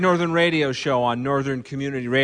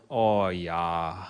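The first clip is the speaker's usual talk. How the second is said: low, soft, drawn-out Minnesotan "Oh, yeah"; Minnesota "oh yeah"; 8 semitones lower, 6 dB quieter than usual. Retold or imitated. Host on a barn radio show mocking the Minnesota "oh yeah" in a low drawl. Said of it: "a little more".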